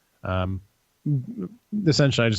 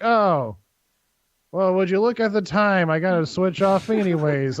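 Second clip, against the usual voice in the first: high-pitched, mock-innocent excuse; feigned casual excuse; 8 semitones higher than usual. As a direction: slip away with a hammed-up "oh, look". Acting out an awkward excuse.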